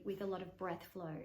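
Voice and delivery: ordinary talk, nothing marked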